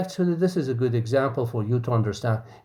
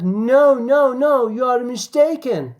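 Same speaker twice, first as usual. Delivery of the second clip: louder, higher protest; insistent denial; 10 semitones higher, 5 dB louder than usual. Acted. D M correcting the teasing friend, raised insistent voice. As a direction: push back hard on a mistaken friend. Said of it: "D M says something".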